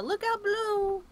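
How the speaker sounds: high, drawn-out call of warning; yelled warning. Pretend. Player yells a warning as he drops the cheese, high drawn-out yell. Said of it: "I'm gonna yell".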